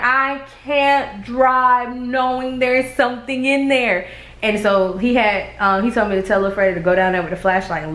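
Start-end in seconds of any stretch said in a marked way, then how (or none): 0.0-4.1 exasperated protest. Retold or imitated. Protesting to husband about the frog.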